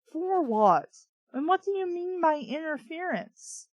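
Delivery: usual speaking voice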